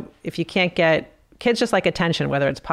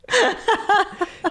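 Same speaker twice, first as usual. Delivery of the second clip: high giggling laugh; high burst of laughter. Real, not acted. Laughing at rule follower.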